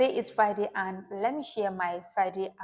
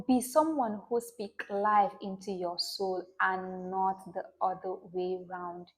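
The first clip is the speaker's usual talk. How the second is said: ordinary talk, nothing marked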